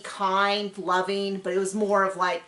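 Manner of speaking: ordinary talk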